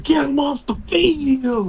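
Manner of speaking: high, drawn-out heckle from the stands; mock fan outrage. Mimicking fans turning on a struggling player, high mock outrage. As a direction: wail it like a fed-up fan, stretched and high.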